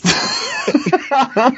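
can't hold the laugh in; high burst of laughter. Real, not acted. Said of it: "but I'm laughing".

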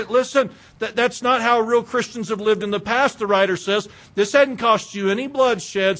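loud, high exhortation from the pulpit; urgent pulpit preaching; full-force preaching. Acted. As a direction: drive it home like a revival preacher. Preaching loudly again.

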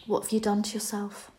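soft, cutting question; quietly appalled, imitating a critical mother; imitated disapproving mum. Mum's put-down, acted in retelling.

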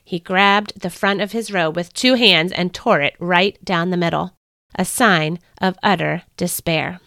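plain reading voice

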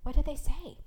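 insistent, urgent asking; urging. A narrator's reading. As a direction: press eagerly for the answer. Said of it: "Joseph urged".

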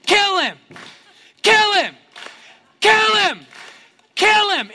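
high, loud repeated shout; crowd yelling. Acted. Angry crowd finds common ground against Paul, yelling. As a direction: yell it as a mob's chant. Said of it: "and they start yelling".

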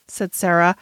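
ordinary delivery, nothing marked

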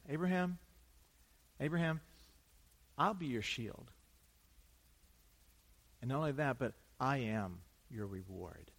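God gently calling Abraham, promising to be his reward; tender voice of God. Acted.

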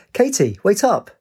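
high-pitched call after someone. A narrator's reading. Friend hurrying down the stairwell after her, calling out.

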